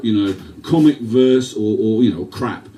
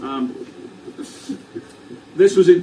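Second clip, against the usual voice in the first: ordinary delivery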